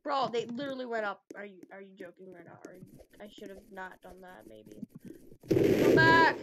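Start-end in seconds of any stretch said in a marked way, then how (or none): 1.4-4.9 very soft, whispery mutter; muttered disbelief. Real, not acted. Player regretting a move, muttering very softly. 5.8-6.4 high, very loud yell; full shout. Real, not acted. Player calling someone back, high full shout.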